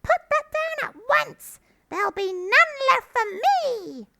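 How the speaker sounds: piercingly high, loud rat protest; outraged shriek. A narrator's reading. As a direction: screech orders like a furious rat. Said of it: "shrieked Rat".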